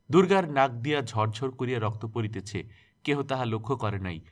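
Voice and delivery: plain reading voice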